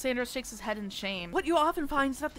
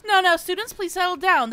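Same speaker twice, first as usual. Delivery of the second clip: higher and louder, calling the class to order; loud teacher plea; 6 semitones higher, 9 dB louder than usual. Acted. Teacher trying to settle rowdy students, raised voice. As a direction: call over a noisy room.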